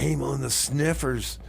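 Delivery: normal talking voice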